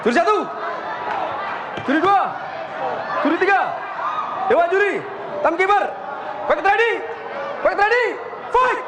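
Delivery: high shouted referee commands; referee calls. Real, not acted. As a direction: bark the start call. Referee calling the fight start.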